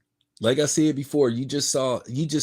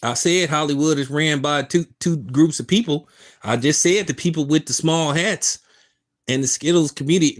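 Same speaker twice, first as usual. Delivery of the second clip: heated and defensive; 5 semitones higher than usual. Real, not acted. Heated reply to a chatter.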